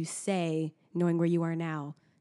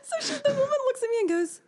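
laughing. Real, not acted. Laughing through the story.

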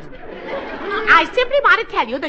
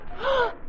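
sharp gasp; 9 semitones higher, 8 dB quieter than usual. Acted. Notices the forgotten camera.

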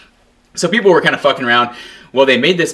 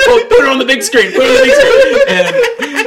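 high, laughing "big screen"; laughing, mock call; 4 dB louder than usual. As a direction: cackle out the demand. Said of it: "I was gonna say".

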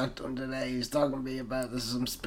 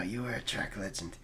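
whispering; 4 semitones lower, 9 dB quieter than usual. Acted. Awed whisper remembering legend.

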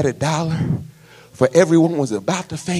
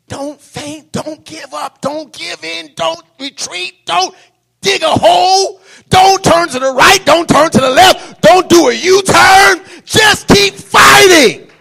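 building preaching; 13 semitones higher, 11 dB louder than usual. Acted. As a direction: build to a roaring climax.

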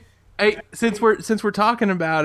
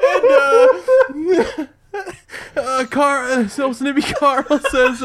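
giggling; 6 semitones higher, 3 dB louder, slower than usual. Real, not acted. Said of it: "Stop making me laugh!".

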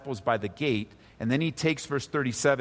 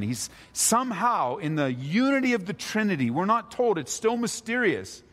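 higher-pitched, fervent sermon voice; impassioned preaching; 8 semitones higher than usual. Real, not acted. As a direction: press the point home from the pulpit, pitched high. Preaches at raised intensity.

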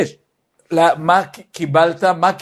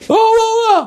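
put-on much higher, louder wordless child yell; child screaming; 3 dB louder than usual. Toddler screaming to get his way, much higher yell. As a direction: howl like a bratty toddler. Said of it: "מי שצועק לא מקבל".

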